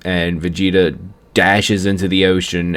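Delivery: usual speaking voice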